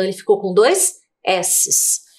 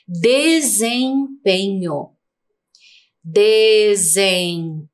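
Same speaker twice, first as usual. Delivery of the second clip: "Desempenho" stretched slowly as DE-ZEM-PE-NHO; slow sounded-out reading of "Desempenho"; syllable by syllable; slower than usual. Shown as a demonstration. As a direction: draw out each of the four syllables of "Desempenho". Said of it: "Quatro sílabas".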